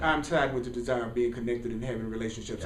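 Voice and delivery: low, soft, quick reply; calm counter-answer. Acted. Speaker answers the voice denying companionship, calm counter-answer. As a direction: drop the pitch and answer unruffled.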